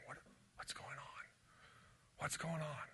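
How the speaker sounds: hushed, frightened whisper; scared whisper. Retold or imitated. Six-year-old on the couch, whispering in fear. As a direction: whisper it toward the closed door. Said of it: "just looking at that door saying".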